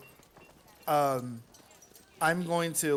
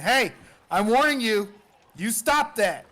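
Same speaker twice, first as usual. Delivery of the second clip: much higher, louder stern warning shout; warning yell; 8 semitones higher, 9 dB louder than usual. Acted. Warlock yells a warning at the Quasit, loud stern. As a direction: yell it to scare the creature off. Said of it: "I'm gonna yell out again".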